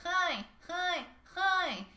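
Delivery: high buzzing hi in the nose; nasal hi. Shown as a demonstration. Teacher demonstrating nasal pharyngeal resonance. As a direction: ring the hi through the nose. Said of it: "if you can say".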